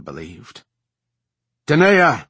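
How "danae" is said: loud, high call of a name; shout. A narrator's reading. Commander calling her second across the camp, shouting. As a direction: shout to get her attention. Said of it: "she shouted".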